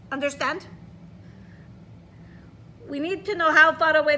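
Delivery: Russian grandma explaining; a touch of Russian accent, patient. Acted. Grandma teaching the child to count the storm, Russian accent. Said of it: "I'm trying to read with a Russian accent".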